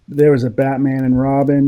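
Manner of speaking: usual speaking voice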